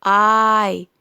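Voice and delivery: "آي" loud and drawn out; long letter name. Shown as a demonstration. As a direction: stretch "آي" as the name of I. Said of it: "ده أسمائهم الحقيقية". ("آي" ممدودة باسم الحرف)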